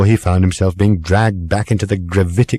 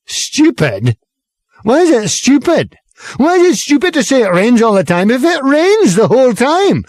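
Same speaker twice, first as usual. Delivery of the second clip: high, loud affronted protest; affronted outrage; 13 semitones higher, 4 dB louder than usual. A narrator's reading. Lorry driver affronted by Arthur, protesting loudly. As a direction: bristle with offence at being called stupid. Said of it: "eyebrows shot up, affronted".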